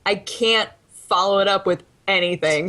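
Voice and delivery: ordinary talk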